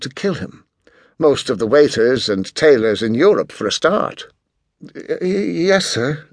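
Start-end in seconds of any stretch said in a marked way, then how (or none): 4.8-6.3 higher, slow, stuttered nervous yes; stammering waitress. A narrator's reading. Waitress stammers to the haughty boy in fright. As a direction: trip over the word in fright. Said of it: "stammered the unfortunate waitress".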